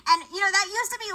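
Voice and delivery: normal talking voice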